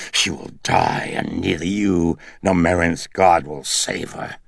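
the demon seething, rising in force. A narrator's reading. The demon seething at Karras, vowing to kill the girl. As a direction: menace him, seething with hatred. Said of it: "It was the demon, seething".

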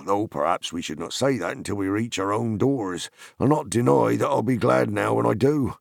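loud, high rustic farmer voice; gruff old farmer. A narrator's reading. Farmer explaining to Merry before heading home, loud and rustic. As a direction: grumble kindly, eager to get home.

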